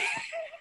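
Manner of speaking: high light laugh; bright laugh after a touching moment; delighted laugh. Real, not acted. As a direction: laugh with happy relief. Laughing after emotional story.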